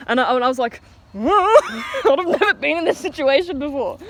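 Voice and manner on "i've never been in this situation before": high, drawn-out words through laughter; amused, breaking into giggles; laughing through words. Real, not acted. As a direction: crack up while telling it. Breaks up retelling own reaction.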